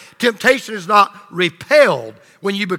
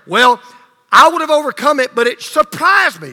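higher, louder whiny excuse-maker; mock excuse; 6 semitones higher, 6 dB louder than usual. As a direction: mimic someone making a lame excuse. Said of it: "so don't come and say".

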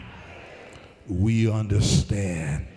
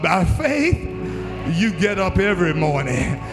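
rising call, shouted on faith; preaching at full force; 5 semitones higher than usual. Real, not acted. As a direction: build the sermon to a roar. Preaching build with music.

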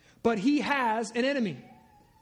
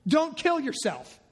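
ordinary talk

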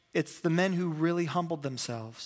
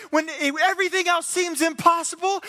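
much higher, loud rallying build-up; rousing coach; 12 semitones higher, 6 dB louder than usual. Retold or imitated. Coach fires up the team, rousing rally. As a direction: push the crowd up with it.

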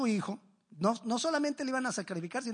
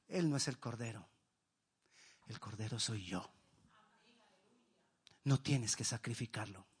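tender reassurance; 10 semitones lower, 9 dB quieter than usual. Acted. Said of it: "le está diciendo".

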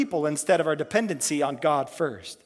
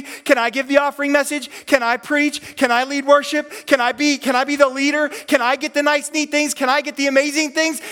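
higher, louder, building whiny pleas; jealous pleading; 6 semitones higher, 3 dB louder than usual. Retold or imitated. Peter begs Jesus for the good things, rising whine. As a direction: beg like a jealous disciple.

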